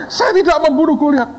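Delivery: very high squealed denial; hammed-up terrified student; mock tearful panic. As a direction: plead innocence in a comic wail.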